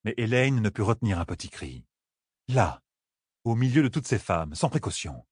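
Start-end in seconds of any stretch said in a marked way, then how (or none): none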